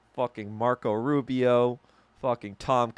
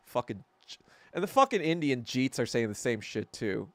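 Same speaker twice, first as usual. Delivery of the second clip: normal talking voice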